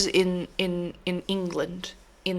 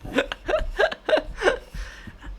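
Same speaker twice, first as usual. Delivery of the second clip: wheezy laugh. Real, not acted. Breathy laugh.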